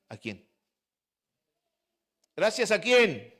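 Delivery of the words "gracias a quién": raised, louder call for an answer; rousing question. Real, not acted. Preacher asks the congregation again, rousing louder question. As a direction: demand the answer from the crowd.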